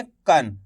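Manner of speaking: कन said with a half na at the end; कन as k plus short a plus nasal n; teacher sounding out. Shown as a demonstration. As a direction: sound out कन slowly and clearly. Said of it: "कैसे करेंगे".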